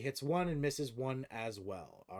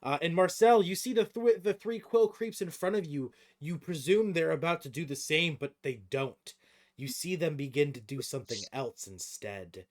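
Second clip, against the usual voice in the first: game master narration; 3 semitones higher, 7 dB louder, slower than usual. Acted. Game master describing creatures fusing for the players, dramatic narration. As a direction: narrate the monster's transformation, drawing out key words.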